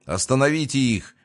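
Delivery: barked order. A narrator's reading. Mortimer ordering his people to attack, barking. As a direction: bark the order without a full shout. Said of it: "рявкнул Мортимер".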